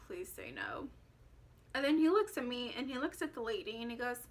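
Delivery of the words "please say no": much lower and softer inner plea; inner thought. Retold or imitated. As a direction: think it, barely voiced. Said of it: "just kept thinking".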